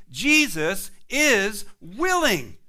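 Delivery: slow down and press "willing" home; preacher hammering the point; slow emphatic declaration. Real, not acted. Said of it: "Listen to me".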